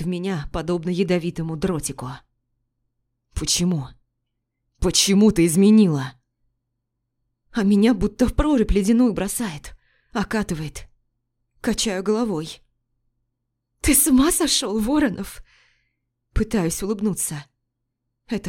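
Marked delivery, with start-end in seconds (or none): 3.3-6.1 rising to a loud accusation; husband confronting wife; pained demand. Acted. 13.8-15.4 higher, incredulous; wife stunned by accusation; shocked disbelief. Acted.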